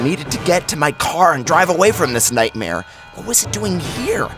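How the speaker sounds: ordinary delivery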